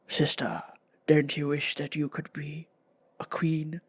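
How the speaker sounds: hushed, low goblin whisper; sinister whispering goblin man; creepy goblin. Retold or imitated. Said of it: "creepy, scary goblin man".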